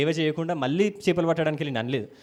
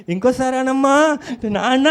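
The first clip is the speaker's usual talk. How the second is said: louder, higher, slow coaxing of a delighted dad; doting father; 7 semitones higher, 8 dB louder, slower than usual. Retold or imitated. A movie father coaxes his little child, slow and delighted. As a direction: beg the child to say it once more, beaming. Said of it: "ఆ చిన్న పిల్లగాడు నాన్న అనగానే".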